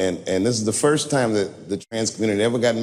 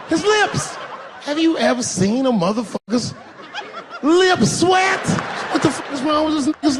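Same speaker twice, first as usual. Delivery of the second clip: high, laughing disbelief; incredulous. Acted. Comic asking the crowd, high laughing disbelief. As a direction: squeal it out in amazement. Incredulous about sweating lips.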